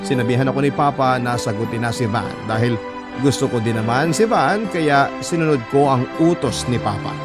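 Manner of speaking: ordinary talk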